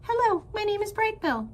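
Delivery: high, chirpy small-bird greeting; gosling introduces himself to anyone, high and chirpy; little gosling voice. Acted. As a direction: greet everyone like a tiny gosling. Gosling voice in story.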